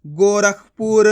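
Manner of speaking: गोरखपुर slow and loud, in long syllables; blended word reading. Shown as a demonstration. Teacher reading the joined word, slow blending. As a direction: join गो-रख-पुर slowly. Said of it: "आपस में लाके पढ़ दो".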